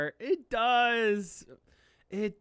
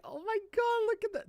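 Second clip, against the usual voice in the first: tiny, very high-pitched gushing; overcome squeal; 14 semitones higher, faster than usual. Real, not acted. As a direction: squeak it out, overwhelmed by the sight. Emotional over favourite scene.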